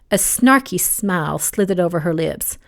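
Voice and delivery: plain reading voice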